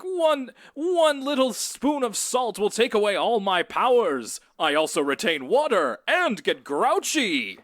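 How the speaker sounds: booming superhero voice, slow and high; Bionic Bunny. Acted. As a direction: proclaim it like a cartoon superhero. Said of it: "in the typical Bionic Bunny voice".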